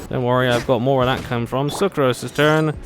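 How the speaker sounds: normal talking voice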